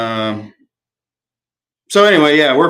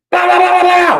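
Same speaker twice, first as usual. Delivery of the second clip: loud, very high burst of mouth-made gunshots; imitated rapid gunfire; 5 dB louder than usual. Host retells Mark shooting off the back porch, imitated gunfire. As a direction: fire off rifle shots with your voice.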